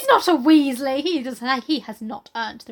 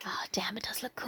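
hushed, breathy aside; whisper; 2 semitones lower, 14 dB quieter than usual. Real, not acted. Friend admiring the man bun, whispered. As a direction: murmur it under your breath.

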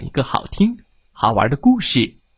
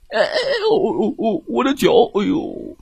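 high yelps sinking into ouch; flustered bear yelping; 7 semitones higher than usual. Acted. Bear with his foot in the can, flustered yelps. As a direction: yelp and stumble over a stuck foot.

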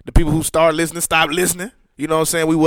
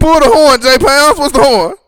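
higher, louder teasing echo; loud mock echo; 10 semitones higher, 10 dB louder than usual. Cohost loudly mocking the horn line. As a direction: throw the line back loudly.

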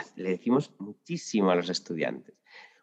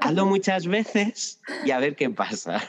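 higher, altered ironic voice; mock advice; 6 semitones higher than usual. Teacher telling the stuck driver to keep trying, mocking. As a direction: shrug it off like a lazy coach.

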